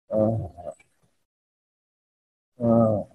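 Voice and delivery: low, soft, weak groan; demonstrated groan. Shown as a demonstration. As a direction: moan faintly, drained of energy. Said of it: "moan or groan like this".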